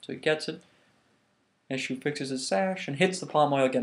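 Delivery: ordinary delivery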